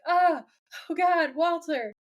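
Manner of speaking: high, panicked plea as he's dragged off; mock terrified cry. Marty dragged toward the fan begs Walter, mock panicked cry. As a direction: yelp it like a man about to die, half joking.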